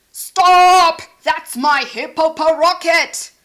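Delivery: very loud shout "Stop!"; furious yell. Acted. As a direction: yell after the thief. Hercules yells.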